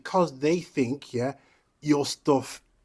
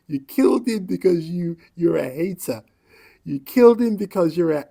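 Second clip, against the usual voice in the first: a lighter angry tirade, raised pitch and a little louder; angry rant; 6 semitones higher, 6 dB louder than usual. Real, not acted. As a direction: accuse them to their faces. Ranting at the killers.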